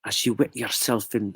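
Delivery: put-on accent drifting into Scottish. Shown as a demonstration. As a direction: Welsh attempt slipping into a Scottish accent. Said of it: "You've gone Scottish".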